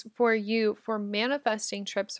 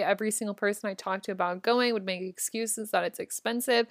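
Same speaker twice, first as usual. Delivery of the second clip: normal talking voice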